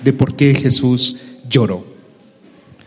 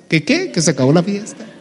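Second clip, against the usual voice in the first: startled disbelief; 3 semitones higher than usual. Acted. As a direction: act taken aback, querying the news with a rising end. Lazarus startled by the news. (incrédulo, sorprendido, en tono agudo)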